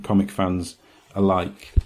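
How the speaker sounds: ordinary talk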